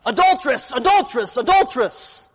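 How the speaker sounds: loud, high cry of "Adulteress!" like a town crier; each "Adulteress!" a loud public accusation; public proclamation. Retold or imitated. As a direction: call out "Adulteress!" over and over to the square. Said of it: "from the public square, it's announced".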